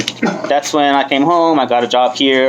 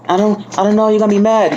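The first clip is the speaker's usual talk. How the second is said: put-on girlfriend; 8 semitones higher, faster than usual. Girlfriend voiced in retold scene.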